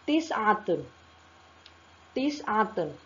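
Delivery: "tis'atun" in distinct syllables, falling at the end; pronunciation demo. Shown as a demonstration. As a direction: model "tis'atun" for learners, then pause and repeat. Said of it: "kita ucapkan".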